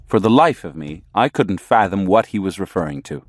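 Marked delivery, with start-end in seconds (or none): none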